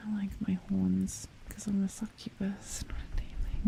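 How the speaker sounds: sultry whisper. Pretend. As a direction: whisper it close and teasing. Teasing whispered succubus talk.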